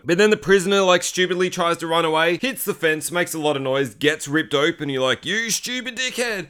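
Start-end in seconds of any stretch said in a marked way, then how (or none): 5.2-6.5 high, slow, softened insult; mock exasperation. Viewer scolding the prisoner who stupidly runs, exasperated. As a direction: drag it out in strained disbelief.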